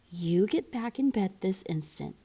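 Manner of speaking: ordinary delivery, nothing marked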